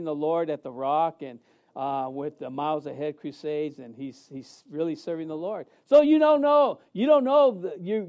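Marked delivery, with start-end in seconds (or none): 5.8-7.6 louder, higher preaching punch; preacher raises the voice; preaching emphasis. Real, not acted. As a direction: drive it home to the congregation. Preaching the point.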